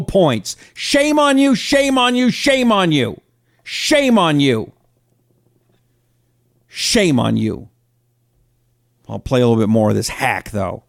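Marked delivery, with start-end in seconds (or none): 0.7-7.7 angry scolding. Real, not acted. Host repeats scolding, winding down.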